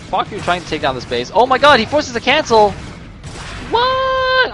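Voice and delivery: usual speaking voice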